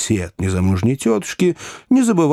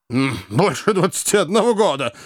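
contemptuous echo, pitched up; scornful; 5 semitones higher than usual. A narrator's reading. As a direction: sneer the claim back. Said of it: "презрительно воскликнул Уордль".

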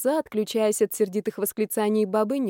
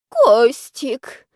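louder, pained moan; faked heart attack moan; 4 semitones higher, 8 dB louder than usual. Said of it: "простонала аферистка".

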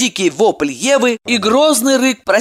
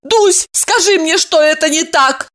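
fluttering cries for help; 9 semitones higher than usual. A narrator's reading. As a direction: beg her, high and lost. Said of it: "растерянно попросила".

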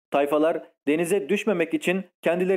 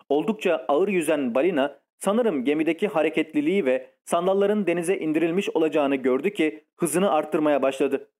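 plain reading voice

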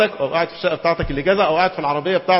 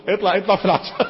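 words breaking into a chuckle; laughing; 3 semitones higher than usual. Real, not acted. Preacher joking with his listeners, laughing as he talks. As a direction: say it through a real laugh.

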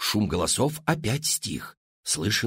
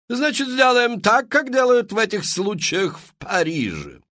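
high, loud, theatrical madame pitching to the crowd; showy saleswoman; 11 semitones higher, 4 dB louder than usual. Acted. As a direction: sell it like a grand Parisian hostess. Мадам Фру-Фру. (высокий громкий голос мадам-зазывалы)

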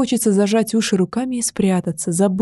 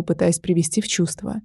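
ordinary delivery

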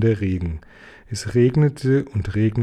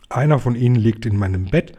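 plain reading voice

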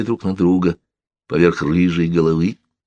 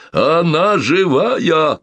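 high, very slow joyful shout; 11 semitones higher, 3 dB louder, much slower than usual. Acted. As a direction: shout with sudden joy. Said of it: "крикнул он".

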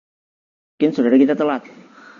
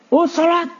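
"salat" yelled high and loud; harsh call; 3 dB louder than usual. Shown as a demonstration. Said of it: "Padahal kan bisa dengan intonasi yang biasa". ("salat" diteriakkan tinggi dan keras)

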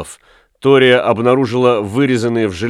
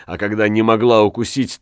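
plain reading voice

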